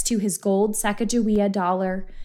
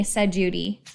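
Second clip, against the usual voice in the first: ordinary delivery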